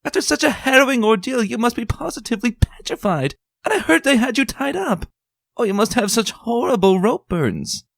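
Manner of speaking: dramatic fussing lady. Acted. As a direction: gush over her friend's ordeal. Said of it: "Rarity echoed dramatically".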